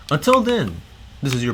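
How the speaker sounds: high, loud hyped sign-off; excited outro. Real, not acted. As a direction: punch it like a YouTube outro.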